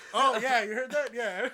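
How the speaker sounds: loud acted reply. Retelling his reply to a buddy at school, loud and laughing. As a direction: blurt it loudly through laughter.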